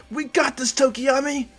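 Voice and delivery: high-pitched, peppy creature voice; eager encouragement. Acted. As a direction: cheer your partner on in a high voice. Dark Shadow cheers Tokiyami on.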